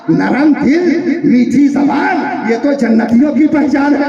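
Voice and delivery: high, loud rising sermon; preaching climax. Acted. Preacher proclaims the Prophet's words, high and loud. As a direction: build to a ringing peak.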